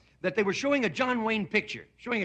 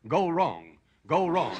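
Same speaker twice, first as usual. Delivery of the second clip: stuck recording; 4 semitones lower, slower than usual. Acted. Recording glitching, repeats.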